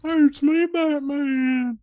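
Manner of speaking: high goofy dopey shout; dumb guy voice. Pretend. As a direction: play Batman as a total doofus. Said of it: "a dumb guy voice".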